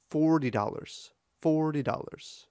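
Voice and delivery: "Forty" with long FOR and quick "-ty" into "dollars"; repeated falling FOR-ty DOL-lars; swinging stress. Shown as a demonstration. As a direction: bounce "Forty dollars" off a stressed first syllable. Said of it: "There is a difference there".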